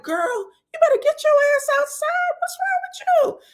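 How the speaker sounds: very high, squealed mock scolding; imitated teasing diners. Everybody laughing at Nell, high mock scolding.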